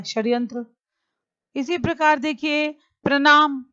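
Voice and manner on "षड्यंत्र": ordinary delivery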